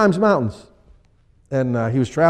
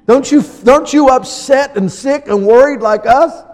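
mock frantic disciples; 6 semitones higher than usual. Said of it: "They had to wake him up and say".

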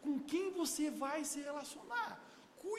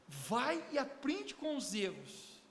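slow emphatic line; much slower than usual. Acted.

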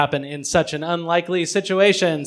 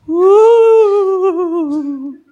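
loud, higher whoop; cheer; 10 semitones higher, 7 dB louder than usual. Real, not acted. Host cheering the next prompt with a loud whoop. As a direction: whoop like a game-show host.

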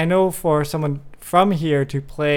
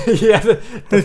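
laughing; 5 semitones higher, 5 dB louder than usual. Real, not acted. Laughing at pork fat joke.

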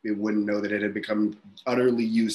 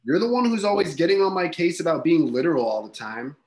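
heated shouting; 7 semitones higher, 5 dB louder than usual. Acted. Partner shouts back in argument.

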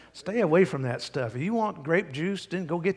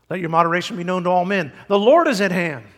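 loud, raised preacher's proclamation; emphatic scripture reading; 4 semitones higher, 9 dB louder, faster than usual. Real, not acted. Preacher reading the verse to congregation, loud proclamation. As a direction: declaim the verse, building to a louder finish.

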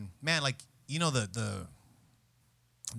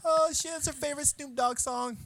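much higher put-on voice of sudden alarm; mock shocked friend; 14 semitones higher, 3 dB louder than usual. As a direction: play someone realizing the song is her favorite.

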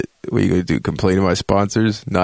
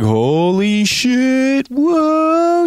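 high, drawn-out awed whoa; mock-amazed holy shit, very high; amazed gasp; 3 dB louder, much slower than usual. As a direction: gasp in wonder at the screen. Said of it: "You're like".

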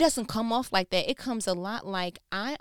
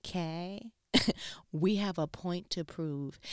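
softer whispered firm aside; hushed advocate; 2 semitones lower, 5 dB quieter, slower than usual. Retold or imitated. Her advocate side whispering back to the peacemaker. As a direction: hush it with resolve. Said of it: "the advocate was like".

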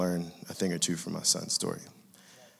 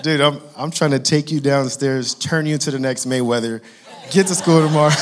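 louder, higher, half-laughing disbelief; exasperated exclaim; 6 semitones higher, 10 dB louder than usual. Retold or imitated. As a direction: throw up your hands at your son, laughing. Dad exclaiming at son, laughing.

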